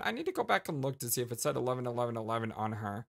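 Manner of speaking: usual speaking voice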